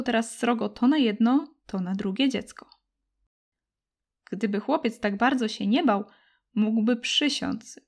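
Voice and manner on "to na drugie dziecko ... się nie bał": plain reading voice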